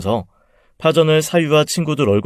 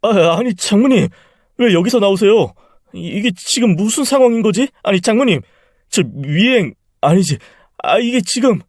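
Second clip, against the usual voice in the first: higher, stammering panic of a caught man; caught off guard; 9 semitones higher, 3 dB louder than usual. Acted. Son-in-law caught by his mother-in-law, stammering panic. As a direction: fumble for words, flustered and scared.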